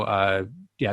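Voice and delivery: ordinary talk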